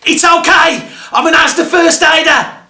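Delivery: high, loud mock shout; imitating a bloke hollering to all around; shouted announcement. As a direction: yell it like an over-keen first aider. Bloke runs up announcing himself.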